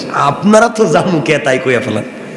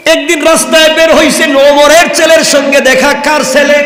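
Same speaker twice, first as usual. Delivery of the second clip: impassioned preaching; 9 semitones higher than usual. Acted. Preacher telling the story of Husain to the crowd, impassioned. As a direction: sweep the crowd along in full voice.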